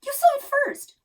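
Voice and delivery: loud, high frantic retort; panicked shriek. A narrator's reading. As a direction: fling it back in a frantic shriek. Mom shrieks back.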